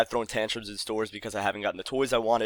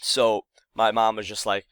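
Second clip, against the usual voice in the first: ordinary delivery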